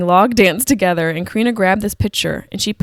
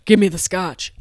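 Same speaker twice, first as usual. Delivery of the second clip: lightly voiced grumpy grandpa; hint of a matter-of-fact old grandpa; blunt grandpa. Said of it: "I'm like the grandpa".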